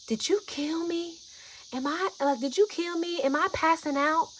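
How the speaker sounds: high, breathy frightened questions; imitated fear. Imitating a tripping friend fearing death, high breathy alarm. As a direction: imitate someone panicking that they are dying. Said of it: "she started to really be afraid".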